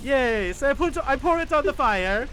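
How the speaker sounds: put-on high-pitched comic Italian accent; squeaky, hesitant Italian helper; cartoon Italian fireman.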